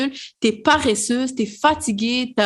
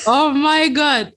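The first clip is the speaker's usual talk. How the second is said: excited relief; 4 semitones higher, 4 dB louder, slower than usual. Real, not acted. Relief after saying the word.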